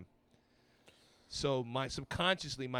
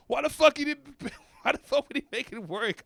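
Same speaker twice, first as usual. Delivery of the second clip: put-on high, loud drunken plea; mock drunken wail with a laugh in it; drunk pleading; 13 semitones higher, 9 dB louder than usual. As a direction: shout it like a wasted caller begging an ex. Imitating drunk calls to exes.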